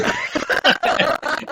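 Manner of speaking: breathy high laugh; cracking up at the memory; unvoiced giggle; real laugh. Real, not acted. Laughing at his own story.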